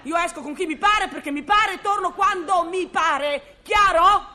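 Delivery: wife shouting down her husband's supposed jealousy, indignant; angry outburst. Acted. Wife escalating jealous-quarrel accusations.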